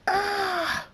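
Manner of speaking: very high sustained shriek; scream. A narrator's reading. Draconix screams again as lightning crackles, screaming. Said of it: "Draconix screams again".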